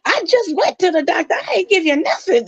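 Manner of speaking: high, indignant woman's voice insisting; imitating side chick. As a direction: squeal the denial like the accused mistress. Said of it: "she said".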